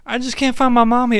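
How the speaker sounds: high, quick mock child voice; little girl voice. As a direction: whimper it like a small kid. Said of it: "and she's like".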